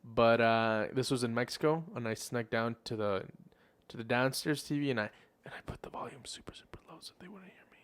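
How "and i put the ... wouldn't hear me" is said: hushed, hurried whisper; secretive whisper. Retold or imitated. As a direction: whisper it like you're sneaking around. Retells sneaking the TV volume down.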